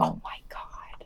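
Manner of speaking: slow, hushed mock-shocked whisper after a gasp; a sharp gasp, then a whispered oh my god. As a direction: play it as scandalized, under the breath.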